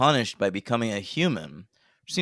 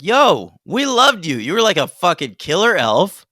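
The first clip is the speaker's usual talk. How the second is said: higher, louder, hyped-up persuading, faster at the end; mock Santa pep talk; 10 semitones higher, 5 dB louder than usual. Santa coaxing the elf to come back, hammed up. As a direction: pitch it like a pumped-up Santa talking him round.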